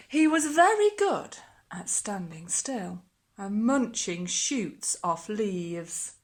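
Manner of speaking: put-on lively storybook reading for children; expressive picture book narration; story reading. A narrator's reading. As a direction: read it like bedtime storytime. Reads picture book aloud.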